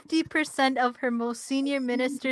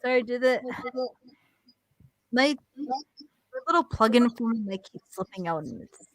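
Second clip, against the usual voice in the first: muffled mic; 4 dB quieter than usual. Real, not acted. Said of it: "you sound, like, muffled".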